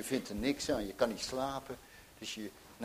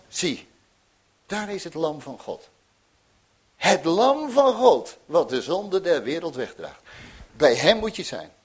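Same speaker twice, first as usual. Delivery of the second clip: full-force preaching; 4 semitones higher, 11 dB louder than usual. Acted. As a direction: proclaim it to the whole church. Proclaiming the Lamb of God. (krachtig preken, luid en hoog)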